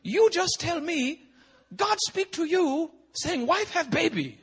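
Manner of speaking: very high, slow imitated Chinese accent; mock Chinese-accented cross-examination; Chinese-accented pastor. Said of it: "I look at the Chinese pastor".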